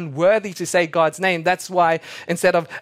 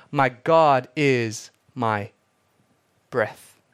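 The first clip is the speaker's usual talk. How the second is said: slow solemn; 3 semitones lower, much slower than usual. Shown as a demonstration. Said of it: "slow solemn emphasis".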